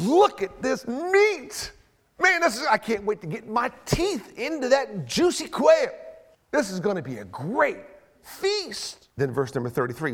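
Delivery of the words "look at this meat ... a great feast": drawn-out, higher-pitched relish; eager gloating. Acted. Israelite eyeing piles of quail, slow greedy relish. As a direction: savor each word like a hungry person.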